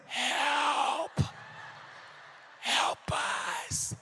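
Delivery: hushed, stretched-out cry for help; whispered plea. Acted. Churchgoer silently begging the pastor for rescue, desperate whisper. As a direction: whisper it like a secret distress call. Pleading inner thought to pastor.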